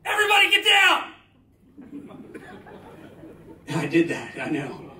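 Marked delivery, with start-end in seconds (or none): 0.0-1.1 high, loud yell of alarm; sudden battlefield yell on a landing plane; panicked yell. Retold or imitated. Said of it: "I sat up straight and yelled". 1.8-3.7 airy laugh. Real, not acted. Laughs at own story.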